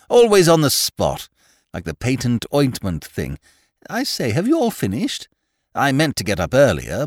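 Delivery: breezy upper-class chatterbox, pitch leaping about; cheerful Lord Peter. A narrator's reading. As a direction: rattle on cheerfully to the whole breakfast table.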